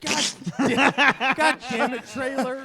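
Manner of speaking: mock exasperation. Hosts scold uninformed newsman.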